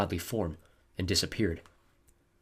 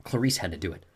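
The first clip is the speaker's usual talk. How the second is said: usual speaking voice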